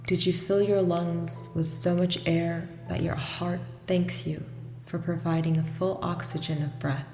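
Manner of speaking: ordinary talk, nothing marked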